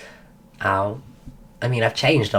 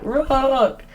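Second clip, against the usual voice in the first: mock cheer; 11 semitones higher than usual. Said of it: "And they all shouted".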